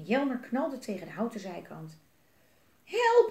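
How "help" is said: very high, loud scream for help. Acted. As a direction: shriek in fright. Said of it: "gilde Elise". (Elise gilt hoog en luid om hulp)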